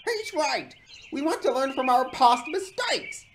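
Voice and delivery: high, loud plea; high-pitched, urgent appeal; pleading. A narrator's reading. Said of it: "pleaded Dodge".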